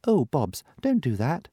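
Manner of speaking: Topsy alarmed at Bobs melting. Acted. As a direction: ask it worried.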